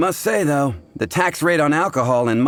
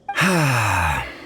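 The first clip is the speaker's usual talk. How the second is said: rueful sigh; 4 semitones lower than usual. Acted. Owner shamed about Jean's workload.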